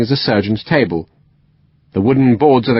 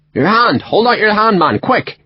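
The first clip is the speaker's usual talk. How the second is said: much higher urgent orders; urgent West Country; 11 semitones higher than usual. A narrator's reading. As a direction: hurry him, hand out. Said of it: "strong West Country accent".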